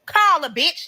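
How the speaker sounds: sharp high yelled command; angry demand. Retold or imitated. To the lying boyfriend, angry high demand. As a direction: bark it at the lying ex. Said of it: "That's what I told him".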